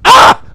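shrill high yelp of a birthing deer; high birth cry. Acted. Preacher crying out as the deer gives birth. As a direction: let out a sudden high cry.

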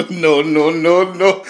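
loud laughing fit, no's squeezed through; helpless laughter. Real, not acted. Reactor cracking up at the video, helpless real laughter. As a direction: crack up, high and loud, barely getting words out.

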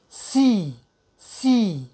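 シー with a drawn-out s, lips stretched wide; lips pulled sideways. Shown as a demonstration. Teacher models the s sound for learners, シー with lips spread. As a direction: stretch the mouth sideways on a long シー. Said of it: "口を横に引っ張って".

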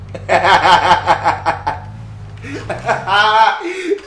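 loud real burst of laughter; loud hearty laugh. Real, not acted. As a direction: crack up out loud. Laughing at game dialogue.

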